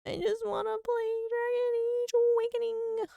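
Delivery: high, slow whiny wail; drawn-out fake cry; mock whine. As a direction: whimper it like a sulky child. Mock whining about game.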